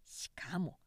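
plain reading voice

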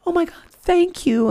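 high squeal of joy; overjoyed. Retold or imitated. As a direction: squeal it with sudden delight. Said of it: "And I said".

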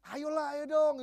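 imitated friendly cajoling; coaxing. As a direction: coax a reluctant friend along. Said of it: "Dirayu, abis dirayu-rayu".

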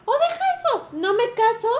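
indignant high shout at the character; outraged cry. Real, not acted. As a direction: cry out in disbelief. Outraged exclamation about Pedro's choice.

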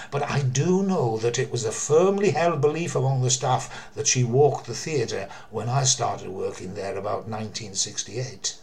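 ordinary talk

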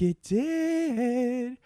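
very high and very slow; high squeak. Pretend. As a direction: stretch it into a squeaky whine.

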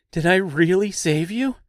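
can't believe his luck; astonished. A narrator's reading. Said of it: "in complete astonishment".

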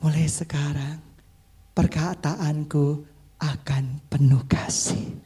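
low, slow, tender pledge; loving. Shown as a demonstration. Preacher models a pledge for neighbours to repeat, loving. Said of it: "Bilang sama sebelahmu".

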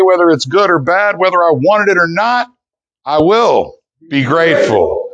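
rising, stirring pledge voice; group pledge. Acted. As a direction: lead the room in the vow. Leads the daily pledge.

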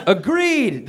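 high sing-out agreement; chimed in unison. Acted. Three harpies agreeing with Calvin, high chiming voice. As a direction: chime it out, lifted in pitch. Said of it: "chimed in unison".

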